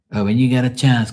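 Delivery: a hint of dad casually roping in his son; imitating dad. Acts out dad asking a favour.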